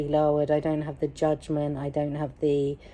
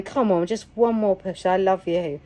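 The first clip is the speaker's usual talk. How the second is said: normal talking voice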